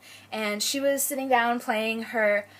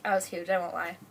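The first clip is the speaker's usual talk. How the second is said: ordinary talk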